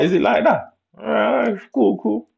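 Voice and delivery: very high, drawn-up retort; high-pitched mock challenge. Accepting a girl's challenge to fight, high mock retort.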